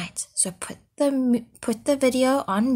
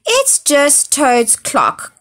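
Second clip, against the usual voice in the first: slow, higher, louder croak; 6 semitones higher, 6 dB louder, slower than usual. Acted. Frog croaking back at the goat, slow and louder. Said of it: "croaked Frog".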